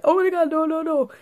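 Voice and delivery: high, panicky wail of no; panicked cry. Retold or imitated. To herself, dreading the wind, a high panicked no. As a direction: squeal it out, scared of the wind. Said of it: "I'm like".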